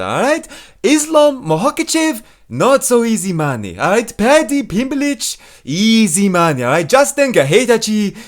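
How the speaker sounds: put-on high, stretched-out Brazilian accent; Renato Moicano impression. Comic imitating podcast fighter, high Brazilian-accented hype impression. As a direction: hype it up, dragging out the names high. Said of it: "moving on up next to Renato Moicano".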